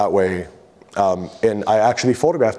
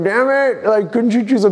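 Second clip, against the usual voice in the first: much higher and louder, exasperated; frustrated outburst; 12 semitones higher, 4 dB louder than usual. Retold or imitated. Frustrated inner voice while being ordered out of the car. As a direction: blurt out the frustration.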